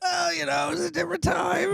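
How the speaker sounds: laughing. Real, not acted. Laughing reply.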